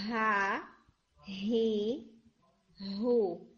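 ہا، ہی، ہو each breathed out clearly; ha hee ho drill. Shown as a demonstration. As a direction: push air out on ہا ہی ہو. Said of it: "تو".

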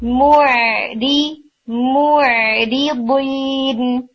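موعدین drawn out very slowly, ain relaxed after the rounded meem. Shown as a demonstration. Tutor modelling موعدین for students, very slow. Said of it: "میم بما اور پھر آئین کو relax کریں".

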